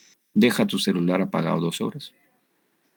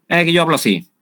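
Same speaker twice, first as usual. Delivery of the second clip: higher and louder, a brash mock shout; loud retort; 9 semitones higher, 7 dB louder than usual. Conductor shouting back at the passenger, higher and louder. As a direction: snap back like an unapologetic shouter. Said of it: "y me grita".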